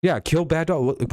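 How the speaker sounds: normal talking voice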